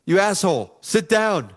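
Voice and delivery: high-pitched mock yell of an angry fan; imitated screaming. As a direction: holler it like a heckler, not full force. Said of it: "starts screaming at me like".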